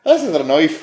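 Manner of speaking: laughing bad Australian "This is a knife", slipping Irish; mock Australian accent. Co-host joins the Dundee quote in a wobbly accent. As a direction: ham up the movie line, accent drifting. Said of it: "Irish or, like, Scottish accent".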